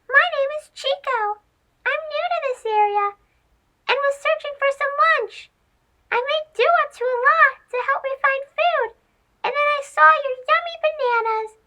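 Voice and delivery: very high, quick squeaky mouse asking politely; new mouse begging the monkey for a banana; polite little mouse. Acted. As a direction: plead sweetly like a tiny mouse. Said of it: "he asked politely".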